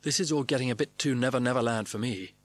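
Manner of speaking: usual speaking voice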